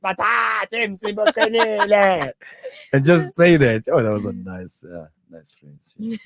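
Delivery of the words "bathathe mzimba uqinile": high, drawn-out mock cry with laughter; mock mom's scream. Co-host copying mom's dance cheer, high drawn-out mock scream. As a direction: stretch the catchphrase out high, half laughing.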